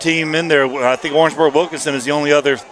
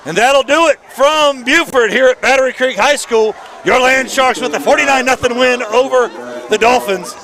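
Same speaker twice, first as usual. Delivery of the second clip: announcer sign-off; 6 semitones higher than usual. Acted.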